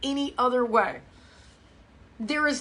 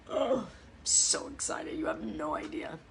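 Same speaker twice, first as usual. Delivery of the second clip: whisper; 3 semitones lower, 9 dB quieter than usual. Acted. Excited about tomorrow's shirt.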